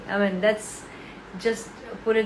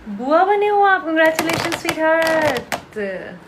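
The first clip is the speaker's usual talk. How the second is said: louder and higher excited congratulations; gushing; 5 semitones higher, 7 dB louder, slower than usual. Real, not acted. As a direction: gush over the viewer loudly. Gushing at a viewer on live.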